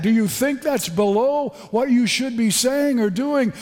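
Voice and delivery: ordinary talk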